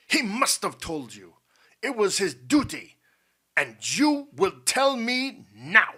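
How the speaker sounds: loud, emphatic, escalating insistence; angry demand. A narrator's reading. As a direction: hammer each phrase, building to a command. General angrily presses the prisoner.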